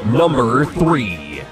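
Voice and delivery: slow, high dramatic announcer call; top ten countdown. Acted. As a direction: stretch it out like a game-show host. Top ten list countdown card.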